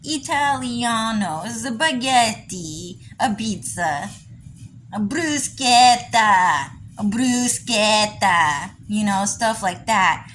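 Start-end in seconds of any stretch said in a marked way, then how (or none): 0.0-8.7 slow, stretched fake Italian words; exaggerated cartoon Italian; mock Italian accent. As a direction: put on a stylized Mario-style Italian accent. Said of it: "real Italians don't even talk like that".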